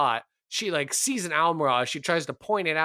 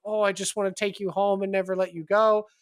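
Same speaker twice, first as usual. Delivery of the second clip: higher, gushing adoration; happy and overwhelmed; 6 semitones higher, faster than usual. Acted. Tiefling petting the almiraj, happily overwhelmed. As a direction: pour out love to a tiny pet. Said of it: "so happy and overwhelmed".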